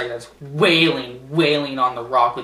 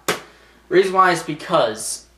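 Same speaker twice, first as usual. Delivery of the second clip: ordinary delivery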